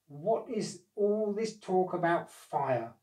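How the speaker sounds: put-on slow, grumpy old gnome; a cross old gnome demanding answers. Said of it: "asked a rather cross voice".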